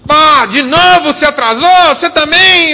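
loud, high exasperated telling-off; angry scolding. Retold or imitated. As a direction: snap at a late colleague. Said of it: "Sabe quando você fala assim".

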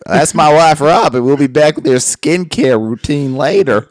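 loud TV host sign-off; hammed-up show host throwing to a break; Doctor Phil. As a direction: project it to the studio audience. Mimicking TV host presenting his wife.

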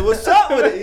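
high, loud startled laughing question. Retold or imitated. Teen confronted by angry neighbor, startled and high. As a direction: blurt it out, caught off guard. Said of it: "And I'm like".

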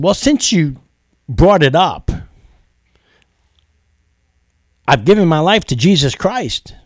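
eager testimony. Retold or imitated. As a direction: proclaim it gladly, lifting the voice.

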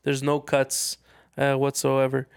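usual speaking voice

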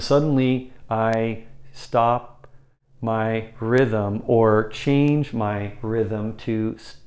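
slow, long pauses between words; halting, deliberately slowed rhythm; spaced-out words. Shown as a demonstration. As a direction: break the rhythm, word by word with pauses. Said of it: "notice I'm just simply spacing my words".

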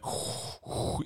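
rasping Darth Vader breath. Shown as a demonstration. Explaining Vader breathing, a rasping breath. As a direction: exhale hard like Vader's mask. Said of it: "imagine if every time you went".

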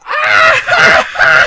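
high, loud re-enacted scream; laughing shriek of someone falling. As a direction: let out a sudden high yell. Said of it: "They just heard me screaming".

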